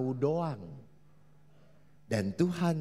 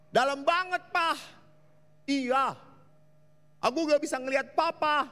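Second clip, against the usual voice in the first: put-on high child calling; a kid worried at the well edge; child's voice; 13 semitones higher, 5 dB louder than usual. Child tells papa it is too dark, high voice.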